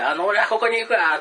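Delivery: high, loud call back; lost man calling back to the voice. Retold or imitated. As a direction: yell so they surely hear. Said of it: "もっと大声で言ってたんですけど".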